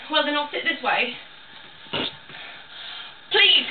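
ordinary delivery

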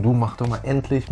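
usual speaking voice

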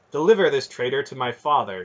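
princess's ringing order. A narrator's reading. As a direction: command it in a ringing voice. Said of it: "she said in a ringing voice".

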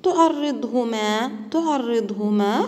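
تعرضهما drawn out slowly with an emphatic ض; slow demo pronunciation. Shown as a demonstration. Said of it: "حرف الضاد في وسط الكلمة". (نطق بطيء لتعرضهما مع تفخيم الضاد)

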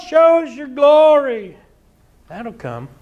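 usual speaking voice

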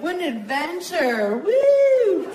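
high, loud sarcastic whoop; fake cheer. Sarcastic about sagging, high loud fake whoop. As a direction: celebrate as if it were good news.

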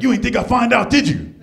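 high, loud mock threat from a big dude; menacing tough guy. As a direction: play the big guy looming over him.

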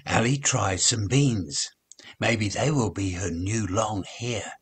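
plain reading voice

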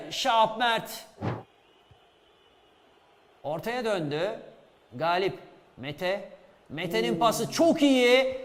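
normal talking voice

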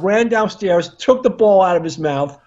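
ordinary delivery

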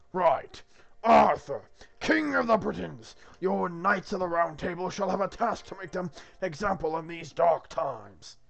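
loud, grand divine proclamation; commanding God. Acted. Plays God giving the quest.

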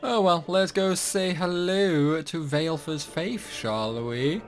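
normal talking voice

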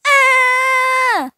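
a sudden high yelp, like being hit; sharp high yelp. Acted. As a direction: yelp in alarm. Game character battle cry.

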